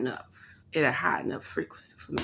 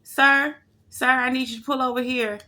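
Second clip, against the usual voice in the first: mock trucker calling out; 6 semitones higher, 4 dB louder than usual. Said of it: "one dude was like".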